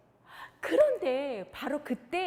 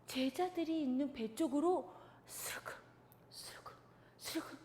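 creeping whisper; 2 semitones higher, 7 dB quieter than usual. Acted.

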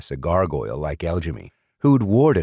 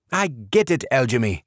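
higher, cut him off; impatient; 7 semitones higher than usual. A narrator's reading. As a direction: stop the bragging. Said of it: "exasperated demon".

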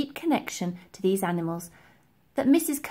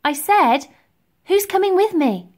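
louder, higher, insistent repeat; insistent demand; 9 semitones higher, 8 dB louder than usual. A narrator's reading. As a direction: demand an answer from silent friends. Girl repeats unanswered question insistently.

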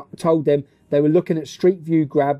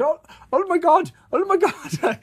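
high, panicked mock Pakistani accent; 12 semitones higher, slower than usual. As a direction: imitate a startled taxi driver in a Pakistani accent. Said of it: "his little Pakistani accent".

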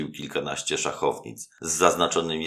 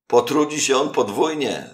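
much higher and louder mocking voice; a guest's jibe; 11 semitones higher, 8 dB louder than usual. A narrator's reading. A guest mocks the young man, loud and much higher. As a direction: tease the young man loudly. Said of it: "rzekł jeden z obecnych".

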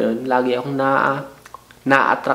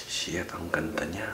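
breathless whispered awe; hushed, dazzled whisper; awed whisper; 9 semitones lower, 15 dB quieter than usual. Retold or imitated. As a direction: whisper it like you're stunned by their looks. Awed aside about attractive person.

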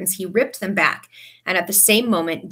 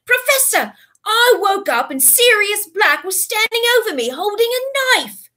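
high, loud desperate plea; frantic pleading; 13 semitones higher, 6 dB louder than usual. A narrator's reading. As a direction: plead it urgently.

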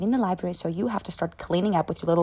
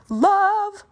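swooning; 14 semitones higher, 9 dB louder than usual. Pretend. Climax of the romance story.

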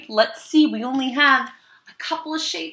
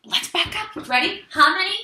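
put-on overbright kids party host; 8 semitones higher, 8 dB louder than usual.

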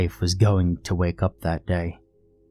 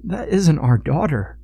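higher-pitched, halting appeal; earnest pleading; 5 semitones higher than usual. A narrator's reading. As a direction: reason with him imploringly, pausing between phrases. Father pleading with son-in-law.

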